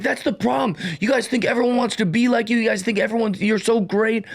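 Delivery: put-on high-pitched bratty kid ranting in a rush; freaking out rant. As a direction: imitate a sulky teen venting his envy. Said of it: "he's, like, freaking out".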